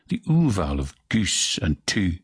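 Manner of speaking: usual speaking voice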